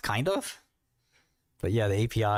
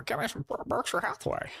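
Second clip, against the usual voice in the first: high, half-voiced squeezed mock voice; strained put-on voice; 3 dB quieter than usual. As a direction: repeat it in a silly strained high voice. Repeats it in a put-on voice.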